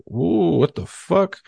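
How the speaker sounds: high-pitched mock alarm; startled "whoa" rising into disbelief; retold startled reaction. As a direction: jump up in pitch, acting startled. Retells reaction to swinging door.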